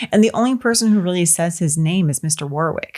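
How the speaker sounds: ordinary talk, nothing marked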